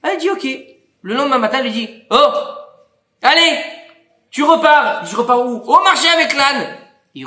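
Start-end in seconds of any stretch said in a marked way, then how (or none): 2.1-6.8 épouse qui le pousse. Retold or imitated. L'épouse le renvoie au marché.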